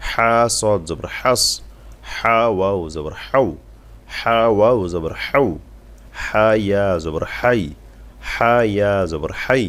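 slow drill with হা pressed from the throat. Shown as a demonstration. Teacher showing how to press হা, slow throaty recitation. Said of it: "হা চাপ দিয়ে দিয়ে গলা দিয়ে দিয়ে পড়বেন".

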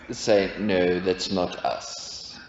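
normal talking voice

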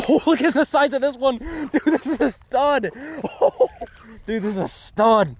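high, giddy excitement with laughter; thrilled. Real, not acted. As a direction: marvel giddily, voice way up high. Marvels at his big catch.

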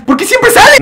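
sudden annoyed squeal; exasperated outburst. Real, not acted. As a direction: throw up your hands at the screen. Annoyed at popup.

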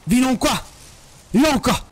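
high, loud panicked shouts; scream, then keep yelling at the threat; startled yelling. Real, not acted. Second scare, yelling at the threat.

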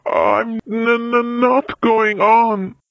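high, slow stuttering refusal; upset stammer. A narrator's reading. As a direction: stammer it out, badly rattled. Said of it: "so upset that he began to stammer".

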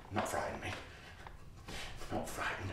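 breathless whisper to himself. Acted. Hidden boy whispering to himself in the dark. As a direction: whisper it so nobody hears.

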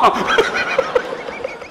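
long breathy high laugh; real amused laughing; laughter. Real, not acted. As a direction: crack up laughing. Laughs at the discovery.

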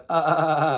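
loud, stuttering quiver on one vowel. Shown as a demonstration. Showing the class how sheep quiver, pulsed bleat. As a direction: let the voice wobble in short pulses. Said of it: "making your voice sort of quiver".

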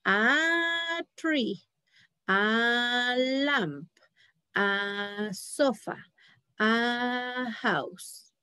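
each "a" phrase read slowly; teacher models a list of a-nouns; slow model words. Shown as a demonstration. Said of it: "a consonant".

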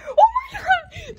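high slow scream with laughter; laughing scream. Real, not acted. Chased by the owner, screaming and laughing.

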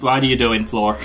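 usual speaking voice

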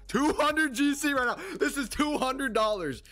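high, keyed-up voice; real jittery hype; nervous excitement. Real, not acted. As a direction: sell the size of the bet, high and tense. High-stakes flip starting.

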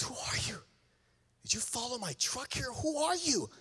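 high, soft retold questioning; shaken disbelief. Crying woman questioning the stranger, shaken disbelief. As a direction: ask it high and hushed, in shock.

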